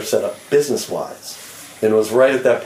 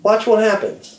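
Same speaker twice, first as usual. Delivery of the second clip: pitched way up, exasperated; worked-up rant; 11 semitones higher, slower than usual. Real, not acted. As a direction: fire it off in disbelief. Ranting about the label.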